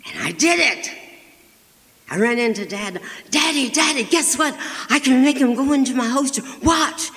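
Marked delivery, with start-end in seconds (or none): 3.3-7.1 put-on much higher and louder, eager child voice; excited little girl. Little girl bursting to show Daddy her trick, excited. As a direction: gush it out like a thrilled kid.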